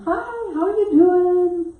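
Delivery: over-bright hello to a grump; mock cheery. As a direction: sing it out sweetly to disarm him. Cheery greeting to grouchy clerk.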